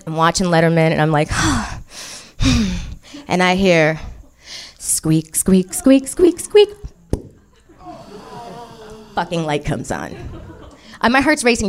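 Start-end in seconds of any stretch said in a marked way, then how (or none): 4.8-6.7 imitated squeaking. Imitating light bulb screwing in.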